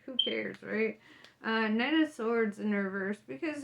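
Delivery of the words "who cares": careless shrug. Retold or imitated. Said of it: "you're just like".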